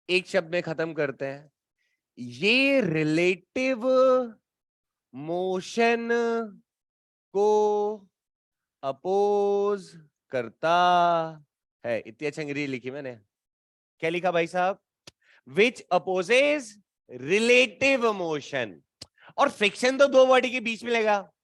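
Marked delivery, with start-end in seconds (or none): 2.2-12.0 very slow, word by word for students to write; teacher dictating a definition, slow and spaced; dictating slowly. Shown as a demonstration. 15.5-18.7 very slow, each English word spaced apart; teacher dictating in English, drawn out; slow dictation. Shown as a demonstration.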